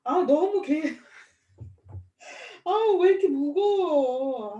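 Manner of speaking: loud tearful cry, slow, with a half laugh; the weight of it breaking through, crying it out; real crying. Real, not acted. Friend cries hearing about her own son, loud and tearful. Friend tearing up over the story.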